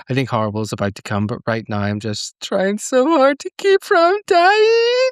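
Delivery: high, crying voice on the last line; real tears breaking through the quote. Real, not acted. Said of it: "I'm gonna cry".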